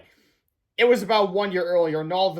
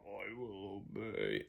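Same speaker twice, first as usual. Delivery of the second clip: very slow, much quieter robot monotone; hypnotized drone; 9 semitones lower, 20 dB quieter, much slower than usual. Acted. Reader doing the hypnotized principal, slow and dazed.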